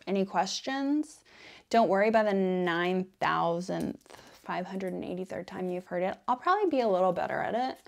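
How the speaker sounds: ordinary delivery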